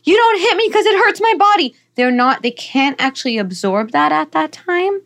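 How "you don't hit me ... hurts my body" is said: high, loud scolding yell; mock shouting parent. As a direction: shriek it like a frazzled parent mid-hit. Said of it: "you're not like".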